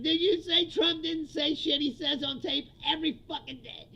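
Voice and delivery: mock yell. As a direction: throw the question back with a hammed-up yell. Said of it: "It's like".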